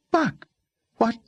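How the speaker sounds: put-on high, startled girl's voice; stunned young woman. Girl character voice.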